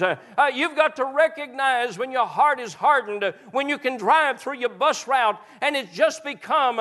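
high, loud, rising revival sermon cadence; full-force preaching. Acted. Pastor urging his congregation not to harden hearts, fervent preaching. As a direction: drive it home like a revival preacher.

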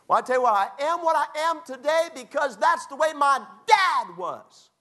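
very high, loud cartoonish whine; hammed-up blame-shifting in a piercing high voice; mock someone passing the buck, high and loud; mock excuse-maker. Mimics people passing the buck.

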